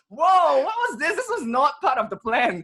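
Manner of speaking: surprised. Retold or imitated. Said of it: "and he's like".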